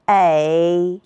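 "A" as ěi, held low with a dip; third-tone "A", long and low; tone drill. Shown as a demonstration. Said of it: "和老师一起来读读".

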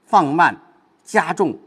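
放慢 and 加重 punched apart; slowed, weighted. Shown as a demonstration. Teacher coaching a reading, firm separated 放慢 and 加重.

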